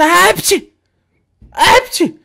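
two loud, high sneezes; sneezing. Acted. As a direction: sneeze explosively, twice. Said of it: "size de ne oluyor". (hapşırma)

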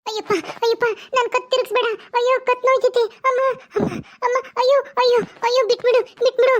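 high crying wails, neck being twisted; pained crying. Acted. Shilpa begs the ghost to let go, crying high wails. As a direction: beg and sob through the pain.

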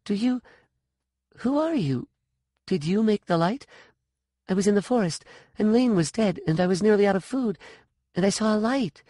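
put-on high, anxious girl's questions; rushing to tell what happened; young girl Sean. A narrator's reading. Sean questioning the woman, high and hurried.

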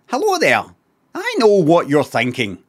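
mimicked Scottish accent, David Tennant style. Streamer mimicking the video's voice, mock David Tennant accent. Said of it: "kinda David Tennant accent".

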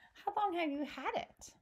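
put-on high-pitched questioning girl character; storybook Sophie, pitched well up; curious Sophie. A narrator's reading. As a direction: ask it in a high, wondering voice. Voicing young Sophie's question.

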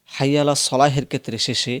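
ordinary talk

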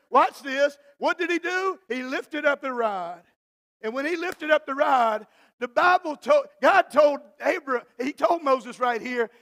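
loud, high, shouted preaching climax; preaching at full force. Real, not acted. Pastor rousing the congregation, shouting loud. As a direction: build it up to a roaring sermon peak.

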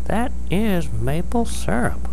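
high-pitched little mole voice; Papa Mole. Acted. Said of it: "said Papa Mole".

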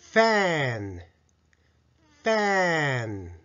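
ファン as a drawn-out English "fan" with teeth-on-lip f; English-style fan. Shown as a demonstration. Teacher modelling the quiz answer, slow English fan. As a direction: stretch the vowel of ファン in one falling syllable. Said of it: "練習問題をやってみましょう".

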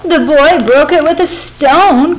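high, loud, slow bird's cry. A narrator's reading. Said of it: "cried Darzee's wife".

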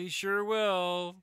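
drawn-out hammed-up ad line; slow mock commercial reply. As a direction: stretch it out like a cheesy radio spot. Buddy voicing the ad.

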